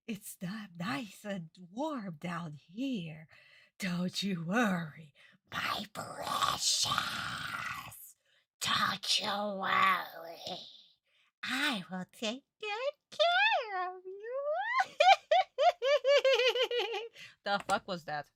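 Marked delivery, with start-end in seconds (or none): 0.1-14.9 hammed-up creepy mother, drawn out and sinister; creepy old-woman voice. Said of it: "That was so creepy". 15.0-17.4 high, loud mock villain cackle; creepy cackle. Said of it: "That was so creepy".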